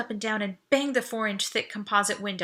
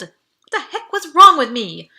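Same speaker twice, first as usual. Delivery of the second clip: self-reproach; 5 semitones higher, 15 dB louder than usual. Acted.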